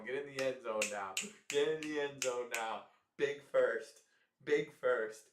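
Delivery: loud fan cheer. Real, not acted. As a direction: shout it at the screen. Cheering a big play.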